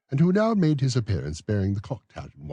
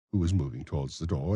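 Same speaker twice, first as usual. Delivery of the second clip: plain reading voice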